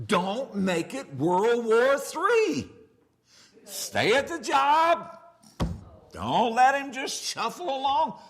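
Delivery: loud, slow pastoral command; preached exhortation. Acted. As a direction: drive the point home to the congregation. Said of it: "preaching exhortation".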